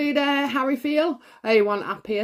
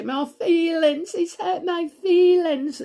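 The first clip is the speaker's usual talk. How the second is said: higher, sulky whine on hurt my feelings; lightly mock a wounded, sulky tone; half-hearted wounded whine; a hint of mock hurt feelings; 6 semitones higher than usual. Mocking wishy-washy feelings talk.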